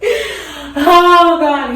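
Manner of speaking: a choked, laughing oh God; teary laugh. Real, not acted. Retelling the ring moment, tearful laughing oh God. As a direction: emotion breaking through mid-story. Overcome recalling the proposal.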